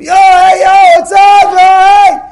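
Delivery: loud, high street holler; screaming. Acted. Rowdy guy greeting someone in the street, screaming. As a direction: yell it down the street to a pal. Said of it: "not to scream".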